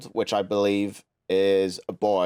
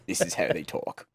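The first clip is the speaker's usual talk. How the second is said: lower-pitched mock British royalist accent; put-on British royalist accent; 4 semitones lower, 4 dB quieter than usual. Shown as a demonstration. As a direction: imitate how British royalists talk. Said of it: "usually they'll talk".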